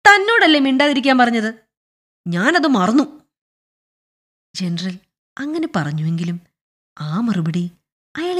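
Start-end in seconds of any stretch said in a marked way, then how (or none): 0.1-3.3 raised, higher annoyed voice; somewhat loud. Acted. General silencing the pestering clerk, somewhat loudly. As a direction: raise your voice to silence him. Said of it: "അല്പം ഉറക്കെ പറഞ്ഞു".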